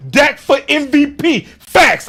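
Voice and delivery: loud, high hype read; booming fan declaration; from the chest. Acted. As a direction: shout it out proudly, with your chest. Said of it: "say that with your chest".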